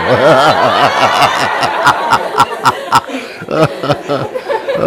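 long squealing giggle; breathless giggle; high giggling laugh. Real, not acted.

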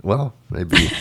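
ordinary delivery

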